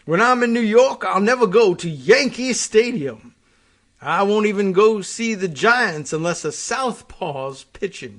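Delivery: loud, high Southern bluster; Senator Claghorn. Acted. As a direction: proclaim it as a hammed-up Southern senator. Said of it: "senator's other anti-Northisms included".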